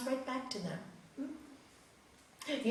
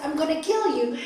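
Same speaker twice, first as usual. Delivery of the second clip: angry threat; 5 semitones higher, 9 dB louder than usual. Acted. Landlord threatening the Swami.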